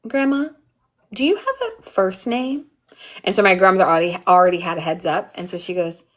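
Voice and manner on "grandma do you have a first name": put-on little boy voice. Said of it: "And he goes".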